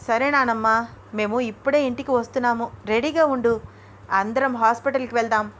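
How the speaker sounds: usual speaking voice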